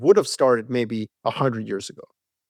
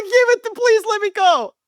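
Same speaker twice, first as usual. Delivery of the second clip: much higher, pleading mock plea; begging eager soldier. Soldier watching the war begs to go, high mock pleading. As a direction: beg like a kid for a toy. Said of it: "you're like".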